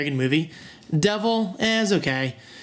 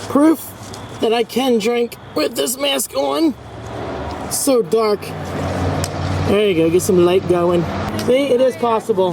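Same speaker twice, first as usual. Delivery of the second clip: muffled behind a mask; talking through a face mask; behind welding mask; 5 semitones higher, 3 dB louder, slower than usual. Real, not acted. Drinking with a mask on.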